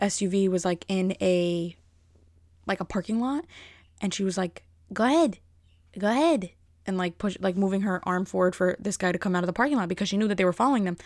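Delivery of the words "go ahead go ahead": imitated waving-on. Imitating her waving the driver on.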